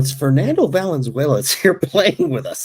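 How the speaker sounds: high, loud, stunned voice; can't believe who's on the team; amazed disbelief. Retold or imitated. Teammate marveling at Fernando in the minors, high amazed disbelief.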